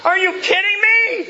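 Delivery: high, loud incredulous yelling; hammed-up disbelief, shouted with no tenderness; uncompassionate exasperation. Dad to daughter upset over a spider, exasperated shout. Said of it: "I got no compassion".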